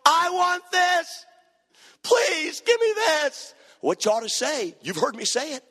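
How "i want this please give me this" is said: high, slow, drawn-out whine; mock crying child. Spoiled child begging at Walmart, mock crying whine. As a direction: wail it like a begging toddler. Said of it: "the baby begins to cry".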